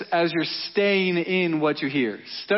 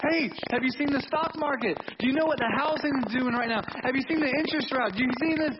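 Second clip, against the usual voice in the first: freaking out; 5 semitones higher, 4 dB quieter, faster than usual. Acted. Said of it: "all of his disciples were freaking out".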